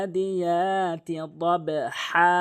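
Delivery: very slow, long vowels in "আদিয়াতি", bounced "দব"; measured, elongated model reading; correct recitation. Shown as a demonstration. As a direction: recite "আদিয়াতি দব হা" drawn out with a throaty final HA. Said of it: "এভাবে উচ্চারণ করবেন".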